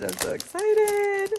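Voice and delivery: giddy high-pitched excitement; excited. Real, not acted. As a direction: squeal it out with delight.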